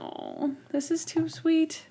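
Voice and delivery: high, soft and slow, choked up with emotion; almost crying at a sweet scene; retelling being moved to tears, high and hushed; touched, tearing up. Retold or imitated.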